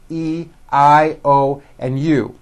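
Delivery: E, I, O, U named one by one, drawn out; reciting vowel letters. Shown as a demonstration. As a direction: spell out E, I, O and U slowly and distinctly. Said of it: "vowel sounds, as you know, are A".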